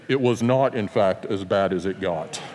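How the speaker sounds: deadpan documentary narration, dropping low; Morgan Freeman voice. Pretend. Imagined movie narrator commenting on the ride, deep and low. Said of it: "The Morgan Freeman voice would now come on".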